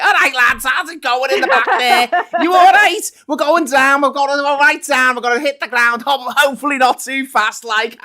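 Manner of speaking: high, loud, broad Scouse pilot announcement; a cheery Scouse captain over the tannoy; Scouse captain landing the plane, cheery and loud. Pretend. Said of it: "he's like".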